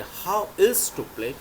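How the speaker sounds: slow teacher model sentence; slow dictation. Shown as a demonstration. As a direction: draw it out slowly with else stressed. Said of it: "ills का प्रयोग करेंगे".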